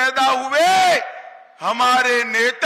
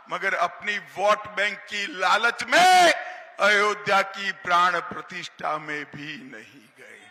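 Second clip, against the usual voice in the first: ordinary talk, nothing marked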